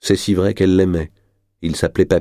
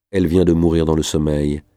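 ordinary delivery, nothing marked

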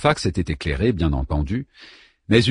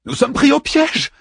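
panicked. A narrator's reading. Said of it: "en roulant des yeux égarés".

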